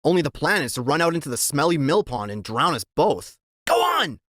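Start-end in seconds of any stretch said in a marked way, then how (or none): 3.7-4.2 high shouted order; shouting. A narrator's reading. Sidekick shouting orders from atop his friend's head. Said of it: "He's shouting from up above".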